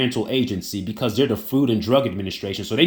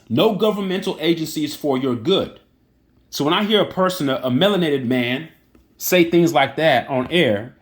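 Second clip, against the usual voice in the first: usual speaking voice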